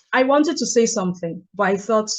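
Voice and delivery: ordinary talk, nothing marked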